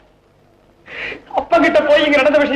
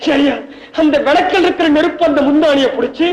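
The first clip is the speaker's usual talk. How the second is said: ordinary talk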